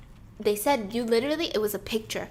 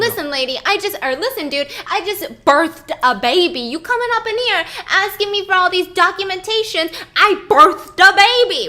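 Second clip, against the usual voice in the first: louder, higher, indignant retold shout; defensive outburst; 9 semitones higher, 11 dB louder than usual. Wife shouting at detectives, defensive and indignant. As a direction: snap back like an offended new mother. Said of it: "she's getting a little bit defensive".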